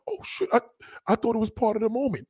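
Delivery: mock embarrassed woman. Woman caught out, acted.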